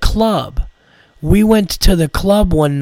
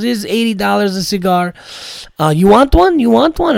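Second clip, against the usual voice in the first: Armenian accent impression; 6 semitones higher than usual. Comic imitating Hamo offering him a cigar in an eager Armenian accent. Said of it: "imitating this guy".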